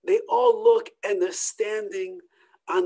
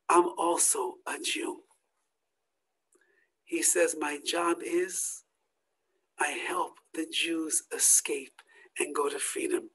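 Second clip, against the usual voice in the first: tearful confession; 6 semitones lower, 7 dB quieter than usual. Acted. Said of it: "he starts crying".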